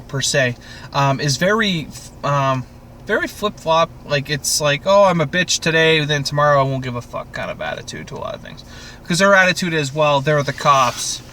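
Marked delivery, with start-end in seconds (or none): none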